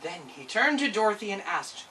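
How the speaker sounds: usual speaking voice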